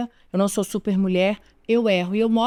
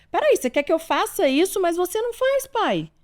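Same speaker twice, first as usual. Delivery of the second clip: put-on high, indignant child talking back; much higher voice, like a child protesting; child's voice; 8 semitones higher than usual. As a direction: play the kid calling out the parent. Child retorting to parent.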